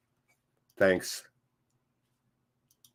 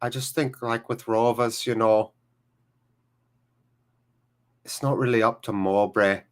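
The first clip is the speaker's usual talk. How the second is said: Alex Lomax; 4 semitones lower than usual. Acted. Streamer trying a Blackburn accent, lower voice. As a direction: imitate a Blackburn accent, pitched low. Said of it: "that's my Alex Lomax".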